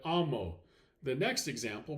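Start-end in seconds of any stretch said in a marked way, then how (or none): 0.0-0.6 "amo" corrected, stressed on the A; AH-mo, falling off after the first syllable of "amo". Shown as a demonstration. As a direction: lean on the opening A of "amo". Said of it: "stress will fall on the first syllable".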